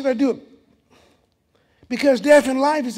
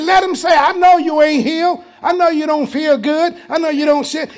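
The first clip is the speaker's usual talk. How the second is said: loud, high, shouted quote; preacher's shout; 5 semitones higher, 7 dB louder than usual. Retold or imitated. Preacher shouting the scripture to the church, loud. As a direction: call it out to the congregation. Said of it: "He said".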